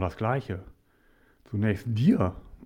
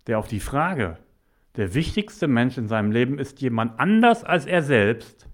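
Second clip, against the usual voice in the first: ordinary delivery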